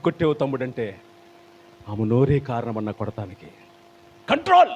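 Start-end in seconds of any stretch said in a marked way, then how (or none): 4.3-4.7 sudden high, loud shout of one word; preacher's burst. Real, not acted. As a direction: bark the word out to wake the room.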